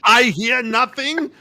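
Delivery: put-on high, loud, slow German-accented denial; hammed-up bumbling German sergeant; Schultz. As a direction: draw it out in a mock German accent. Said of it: "That's Schultz".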